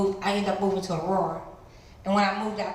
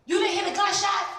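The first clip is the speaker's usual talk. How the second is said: put-on alarmed kid; 11 semitones higher, 4 dB louder than usual. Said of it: "kid asks her, alarmed".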